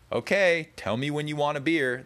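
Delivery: sing-song "tell me when you want a beer"; cheery offer. Retold or imitated. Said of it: "That's what I say".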